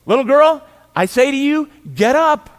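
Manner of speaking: put-on a high, loud command to the dead girl; commanding call. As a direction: order the girl to rise with full authority. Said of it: "he just speaks to the corpse".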